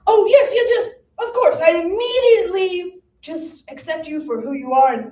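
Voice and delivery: put-on high, loud old gentleman ghost; hammed-up Benjamin Franklin ghost. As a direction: play the pompous founding father. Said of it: "he's like".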